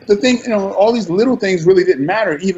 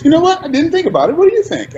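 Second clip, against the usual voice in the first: much higher, light mock voice of the director; imitating Christopher Nolan; 11 semitones higher, faster than usual. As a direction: play the director, high and breezy, tossing the question back. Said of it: "and he goes".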